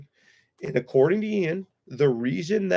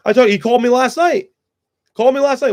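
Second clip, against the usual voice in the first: higher and louder, suddenly fired up; heated outburst; 9 semitones higher, 7 dB louder than usual. Real, not acted. Telling the stream how Ian called him, in a higher, louder real outburst. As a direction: burst out in real anger. Heated rant about late-night call.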